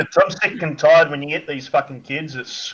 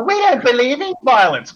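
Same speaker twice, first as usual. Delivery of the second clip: lightly higher, prissy mock voice; mocking pious parent; 7 semitones higher than usual. Mocking parents who preach nonviolence, light prissy sneer. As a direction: parrot it like a smug parent. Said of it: "they don't sit back and go".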